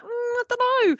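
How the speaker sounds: high, doubtful hum then a dismissive "I don't know"; reluctant bureaucrat. Retold or imitated. Mimicking the annoying commandant, high and hesitant. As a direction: sound like a fussy official stalling. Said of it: "But he was just like".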